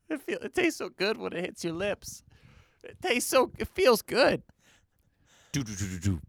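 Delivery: high, strained movie-line delivery; excitable high-voiced film quoting; movie quote impression. Quotes Old School movie lines excitedly.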